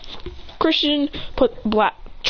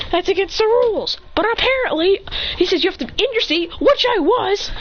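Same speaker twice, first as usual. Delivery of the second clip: high-pitched acted rant; indignant complaint; 10 semitones higher than usual. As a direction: protest the unfair rules, pitched well up. Student complains about rules.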